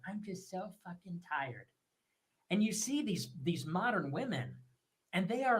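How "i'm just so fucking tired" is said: much softer, limp put-on moan; ridiculing online complaints; mock weary post. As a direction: read it like a drained Twitter sigh. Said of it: "you see it on Twitter all the time".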